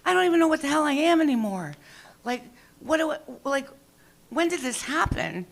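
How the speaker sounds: high, slowing panicked wail; mock panic. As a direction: fake meltdown to a friend. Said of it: "sitting there going".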